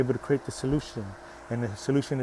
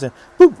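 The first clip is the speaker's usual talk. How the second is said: high, loud cartoon boop; playful sound effect for a ring falling; 11 semitones higher, 13 dB louder than usual. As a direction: pop the sound out like a drop.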